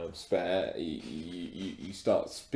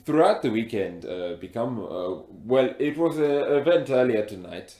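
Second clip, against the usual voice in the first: put-on heavy African accent; 3 semitones higher, 6 dB louder than usual. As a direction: speak with a thick African accent. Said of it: "you've got a very thick accent".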